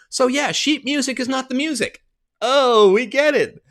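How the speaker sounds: high-pitched, exasperated driving home. Real, not acted. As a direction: hammer the obvious point. Exasperated rant.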